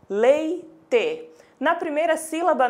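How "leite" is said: "Leite" said slowly, split into its syllables. Shown as a demonstration. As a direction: spell out "Leite" by ear, syllable by syllable. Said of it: "Pronunciem agora devagar".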